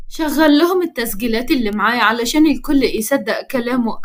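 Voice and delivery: ordinary delivery, nothing marked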